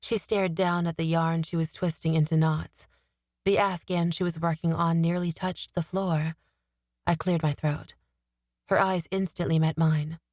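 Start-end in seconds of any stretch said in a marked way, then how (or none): none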